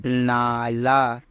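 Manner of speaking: नाला drawn out very slowly; slow reading demo. Shown as a demonstration. Teacher reading a new word to students, very slow. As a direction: sound each letter out slowly. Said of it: "ये क्या हुआ?".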